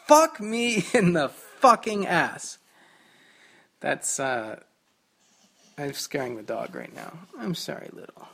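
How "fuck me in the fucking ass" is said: louder slow drawn-out curse. Retold or imitated. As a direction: spell out each word of the curse. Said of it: "sometimes I say".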